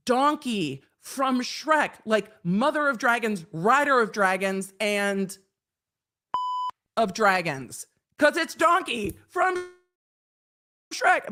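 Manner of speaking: high, slow, drawn-out mock exasperation; exasperated explaining. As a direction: spell it out like he should obviously know. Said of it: "And we're like".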